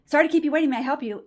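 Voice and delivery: abrupt, startling front-desk greeting, imitated; jarring phone greeting. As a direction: burst in loud and high like a harried receptionist. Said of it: "it's like whiplash".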